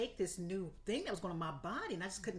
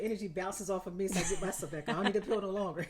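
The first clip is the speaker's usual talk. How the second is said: louder, talking through real laughter; laughing teasingly; 7 dB louder than usual. Real, not acted. Teasing Ashley about always being up, laughing. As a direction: tease her through the giggles.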